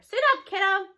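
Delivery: loud, bright call to suit up; hollered cheerful order; grandma shouting cheerily. Acted. G-Ma calls out cheerfully.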